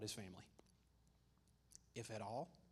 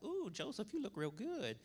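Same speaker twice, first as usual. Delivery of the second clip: high-pitched, swooping mock-seductive coo; flirty wife; 9 semitones higher than usual. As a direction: coo it high, admiring him playfully. Potiphar's wife flirts with Joseph.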